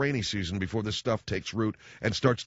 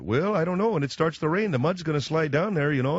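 put-on higher, singsong Minnesota accent; Chicago-Minnesota accent; 6 semitones higher, 4 dB louder than usual. Father-in-law warning about mud on the hill, high Minnesota accent. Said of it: "sort of a Chicago, Minnesota accent".

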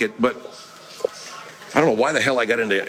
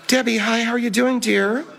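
much higher, put-on sociable voice; high-pitched character greeting; 11 semitones higher than usual. Host greets listener Debbie in a high put-on voice.

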